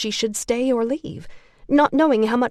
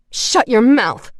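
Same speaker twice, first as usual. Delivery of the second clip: slow, louder furious yell; 3 semitones higher, 8 dB louder, slower than usual. A narrator's reading. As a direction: scream at her to stop. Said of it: "Shannon yelled".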